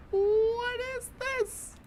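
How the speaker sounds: high, very slow words stretched by laughter; laughing. Real, not acted. Player asking about the dialogue mid laughing fit. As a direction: wail it out through the giggles.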